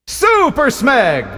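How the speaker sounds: high, loud, slow announcer intro; announcer shout. Acted. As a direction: belt out the title like an announcer. Announcing the clip with fanfare.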